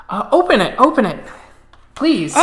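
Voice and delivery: giddy insistence; eager urging. Acted. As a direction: urge her to open the present. Sister urges opening the gift.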